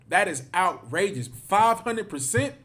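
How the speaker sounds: a stunned, indignant cry; outraged disbelief. Real, not acted. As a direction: let the shock rise into the question. Outraged at statistic.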